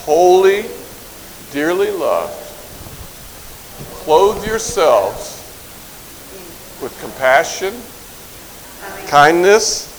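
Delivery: drawn-out words with long pauses between; slow recitation. Shown as a demonstration. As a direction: leave room for the group to repeat. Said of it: "I will say it slowly".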